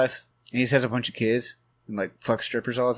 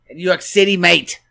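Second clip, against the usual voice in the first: loud, higher-pitched put-on Irish accent; mock Irish accent; 6 semitones higher, 8 dB louder than usual. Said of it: "That's my Irish accent".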